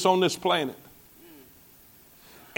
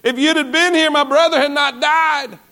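pleading grief; 7 semitones higher than usual. Retold or imitated. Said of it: "the exact same thing as her sister".

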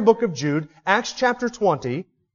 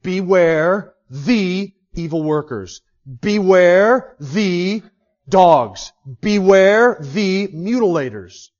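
each "Beware" slow, louder and heavily stressed; hammered warnings; 6 dB louder, much slower than usual. Shown as a demonstration. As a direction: pound out every "Beware" like a bell. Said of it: "You hear that?".

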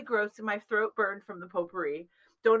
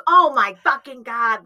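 loud exasperated "Oh my fucking God"; retelling mom's furious reaction, louder; pissed-off mom; 9 dB louder than usual. Retold or imitated. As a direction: let the mom's anger burst out loud. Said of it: "was pissed off".

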